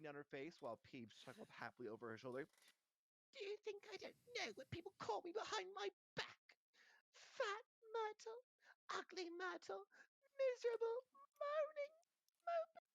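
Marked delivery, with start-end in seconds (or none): 3.4-12.9 very high tearful wail, building louder; anguished ghost girl. A narrator's reading. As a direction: wail each insult, growing more hysterical. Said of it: "burst into anguished sobs".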